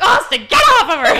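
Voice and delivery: very loud, high mock order; retold dad's yell. Dad pulling son off mom, imitated yelling. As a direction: bellow it as an alarmed parent, laughing underneath.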